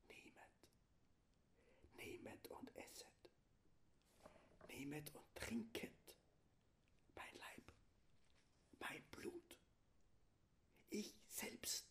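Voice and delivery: very soft voiceless whisper. Shown as a demonstration. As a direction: whisper the words of consecration in a dark church. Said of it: "Stimmlos geflüstert". (im Flüsterton, stimmlos)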